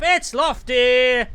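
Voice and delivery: loud, high, drawn-out name reveal; hyped host introduction. Pretend. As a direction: announce the co-host like a big act. Announcer-style intro of the co-host.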